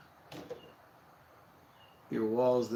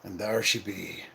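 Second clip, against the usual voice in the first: lower, rough-edged pirate talk; 6 semitones lower than usual. Pretend. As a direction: say it like an old sea pirate. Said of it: "I don't know why I said that like a pirate".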